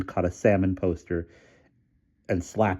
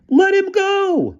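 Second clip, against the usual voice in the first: very high, slow, louder bellow; 7 dB louder, much slower than usual. A narrator's reading. Bruno bellowing at Kowalski to let his friend go. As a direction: roar it at the officer. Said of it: "bellowed Bruno".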